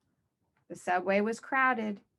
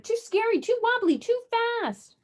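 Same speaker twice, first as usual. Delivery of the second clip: much higher, louder frightened cries; panicked shout; 14 semitones higher, 4 dB louder than usual. A narrator's reading. Cyclops on the roller coaster, high frightened shouting. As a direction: yelp out every fear. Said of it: "Cyclops shouted".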